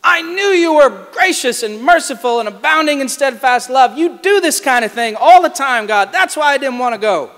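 loud, angry tirade; furious complaint. Retold or imitated. Jonah berating God for his mercy, loudly angry. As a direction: rant at God, bitter and loud. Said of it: "Jonah is furious".

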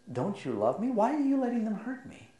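put-on quiet child's voice asking why; hurt child's plea. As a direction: plead like a frightened toddler. Father voicing his hurt son.

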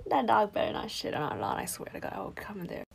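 low, whispered threat under the breath; muttered threat. Acted. Girlfriend privately threatens neighbor over the dog, muttered low. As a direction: drop to a hushed, private grumble.

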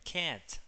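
"cat" with its A leaning to E; vowel toward E. Shown as a demonstration. Teacher corrects student's vowel, "cat" leaning toward E. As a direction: open front vowel in "cat", between A and E. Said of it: "más tirando a E".

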